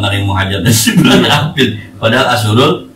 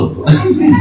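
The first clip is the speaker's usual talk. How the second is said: short high real laugh; 11 semitones higher, 4 dB louder than usual. Real, not acted. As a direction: chuckle loudly. (tertawa pendek)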